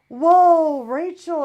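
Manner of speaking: loud, high, drawn-out whoa; startled call. Retold or imitated. Mom seeing the flung hat, startled loud call. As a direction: call out in amazement to your daughter.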